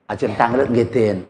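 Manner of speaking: low, soft, deferential question; bow your head as you ask; a mildly hushed humble Javanese greeting. Retold or imitated. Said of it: "langsung nunduk dua-duanya".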